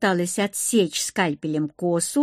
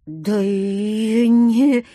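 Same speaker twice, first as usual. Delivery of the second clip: strained, drawn-out croak, louder; choked wheeze; 5 dB louder, much slower than usual. A narrator's reading. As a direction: squeeze words out as if throttled. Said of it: "просипела я". (сдавленный натужный сип)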